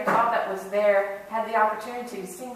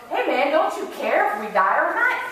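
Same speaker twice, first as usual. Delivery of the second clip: high, loud, swooping exasperated appeal; exasperated urging; 4 semitones higher, 4 dB louder than usual. Acted. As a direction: urge a lazy companion to get moving. Castaway scolds idle companion in joke.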